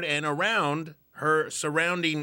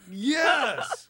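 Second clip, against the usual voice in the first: excited cheer; 11 semitones higher than usual. Real, not acted. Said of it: "cheering after the sign".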